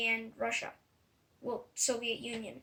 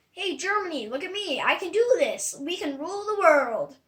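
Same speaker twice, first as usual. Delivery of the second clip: higher and louder, puffed-up dictator bragging to the crowd; boastful Hitler; 5 semitones higher, 8 dB louder than usual. Retold or imitated. Child retelling history, voicing Hitler rallying Germany with a loud boast. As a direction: sell it like a cocky ruler rallying his people.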